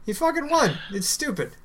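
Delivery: outraged disbelief; indignant. Real, not acted. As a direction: pitch jumps high on won. Indignant rant about player.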